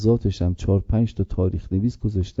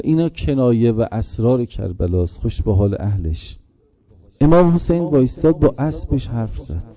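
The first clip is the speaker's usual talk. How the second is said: rising mournful rowzeh recitation; mourning recitation; 3 semitones higher, 3 dB louder than usual. Acted. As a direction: chant the tragedy to stir the mourners. Maqtal recitation, mourning chant.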